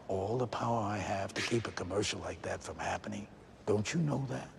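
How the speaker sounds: low, hushed mob-boss menace; Godfather impression. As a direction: lean in like a Mafia don making a threat. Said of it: "Is he seriously breaking out The Godfather?".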